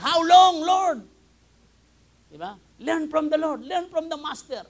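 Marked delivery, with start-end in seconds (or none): 0.0-1.0 loud, much higher cry of how long; shouting. Retold or imitated. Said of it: "Sumisigaw siya". 2.8-4.6 loud, much higher preaching cry; full-force preaching. Acted.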